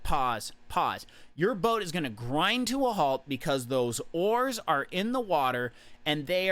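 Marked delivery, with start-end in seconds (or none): 0.0-1.1 slow, each pause lower and falling; halting repetition. Shown as a demonstration. Coach acting out a boat that keeps stopping, halting. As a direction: stop and start, dropping each time. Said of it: "you're rowing a boat".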